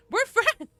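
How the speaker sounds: high, loud exclamation; indignant shout. Real, not acted. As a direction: yell it in offended protest. Mock hurt outburst at chat.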